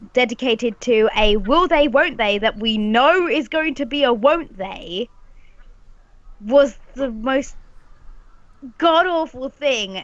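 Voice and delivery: ordinary talk, nothing marked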